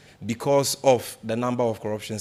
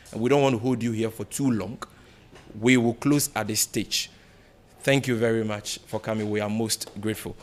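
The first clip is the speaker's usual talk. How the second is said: ordinary talk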